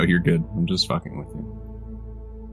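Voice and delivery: ordinary talk, nothing marked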